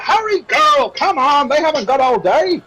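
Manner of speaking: high, loud innkeeper chivvying staff; impatient innkeeper. Acted. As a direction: hurry the serving girl along. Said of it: "He's like".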